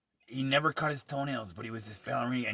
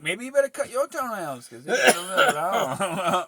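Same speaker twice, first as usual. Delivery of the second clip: mock gentle advice; 8 semitones higher, 8 dB louder than usual. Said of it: "I'm like".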